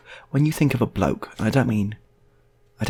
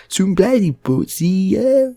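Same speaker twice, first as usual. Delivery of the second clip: high, loud, slow slur; garbled bloke; 10 semitones higher, 7 dB louder, much slower than usual. Retold or imitated. Mate grunting about paintings, loud and slurred.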